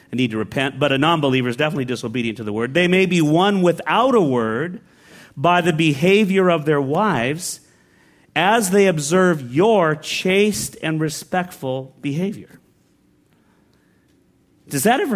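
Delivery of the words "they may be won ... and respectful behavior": deliberate Bible reading; scripture reading. Acted. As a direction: lay each word of the verse down slowly. Reads scripture aloud.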